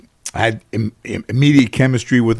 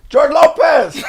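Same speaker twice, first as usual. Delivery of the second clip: high, loud yelled name shot across the street; 3 dB louder, slower than usual. Retold or imitated. Homeless man under the underpass yells at the passing car, a high loud shout. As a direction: call out his name in sudden recognition.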